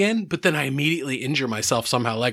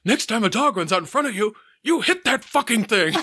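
raised, gruff imitation of a father; dad's stern order; 8 semitones higher than usual. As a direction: bark it like an angry dad, lightly. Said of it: "his response was".

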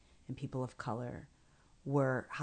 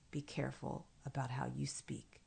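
hushed, protective mother's warning; whispered warning; 8 dB quieter than usual. Retold or imitated. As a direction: whisper it like a mother guarding her child.

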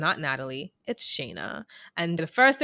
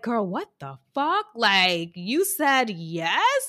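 higher, louder, stretched out yes in shock; incredulous disbelief; 3 semitones higher, 4 dB louder, much slower than usual. Retold or imitated. Recapper reacting to the proposal, incredulous and stretched out. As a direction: drag out the yes like you can't believe it. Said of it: "I was like".

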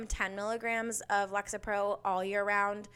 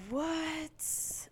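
mock surprise; 8 semitones higher, 4 dB quieter than usual. Mock amazement at a quote.

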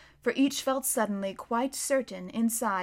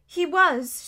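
a touch of misery, higher; miserably; 7 semitones higher, 7 dB louder, slower than usual. A narrator's reading. Lucy admitting Edmund was there, mildly miserable. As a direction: admit it unhappily. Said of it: "she said miserably".

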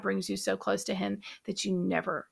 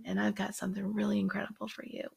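whisper; 7 dB quieter than usual. Retold or imitated. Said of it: "he says it in a whisper".

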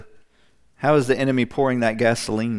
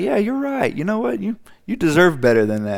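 high mock flattery; 8 semitones higher than usual. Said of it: "He's like".

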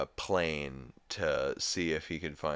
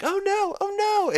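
very high, loud mock panic; panicked cry; 9 dB louder than usual. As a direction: wail it in exaggerated alarm. Acting out the family's alarm.

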